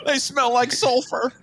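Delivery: high mock conspiracy voice; Alex Jones impression. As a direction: squeal it like a ranting host. Mocking Alex Jones claim.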